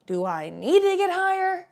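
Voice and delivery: exaggerated sitcom wisecrack, slow and high; hammed-up sitcom character question; Chandler from Friends. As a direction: stretch it out, pitch climbing high on the end. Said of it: "like I was Chandler from Friends".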